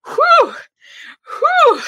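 two high, loud whooping exhales; exaggerated high "whew", twice over; hot-and-bothered whew. Reacts to steamy passage.

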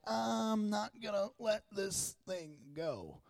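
put-on cling to it like a stubborn child. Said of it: "And I'm like".

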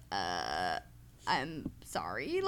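low, slow awkward apology; awkward low sorry. Retold or imitated. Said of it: "I was like".

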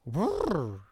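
lightly voiced whinny; mock horse whinny. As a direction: make a silly horse sound. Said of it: "Mister Ed just goes like".

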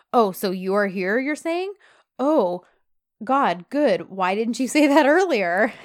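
exaggerated sarcasm, retold; mock sarcastic. John snipes at Yeet by the hospital bed, sarcastic. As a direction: play up the fake surprise with lively pitch. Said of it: "John is so sarcastic".

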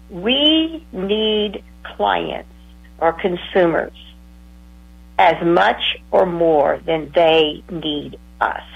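very slow, weighted words; emphatic slow quote. Retold or imitated. Colleague stating the bottom line to counselors, very slowly. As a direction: lay down the bottom line deliberately.

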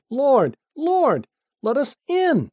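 high-pitched, urgent appeal; pleading call. Acted. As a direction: call out to be let in. Latecomers plead at shut door.

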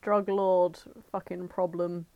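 usual speaking voice

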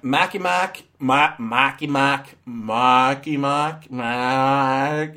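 put-on slow, fumbling stab at a Boston accent; attempted Boston accent. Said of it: "I'm not good on accents".

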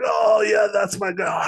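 light mock exclamation of an old man spotting his artist; hammed-up joyful "oh yeah"; delighted recognition. Old label boss sees [name] footage.

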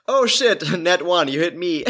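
high, laughing burst of surprise; surprised laughing. Real, not acted. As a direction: blurt it out through a laugh. Dice roll surprise at table.

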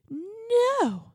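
high mock-British "no"; bad British accent, pitched high and loud; horrible British accent. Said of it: "my horrible British accent".

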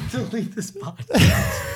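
high, slow laughing plea. Real, not acted. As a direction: beg through laughter. Laughing plea after awkward moment.

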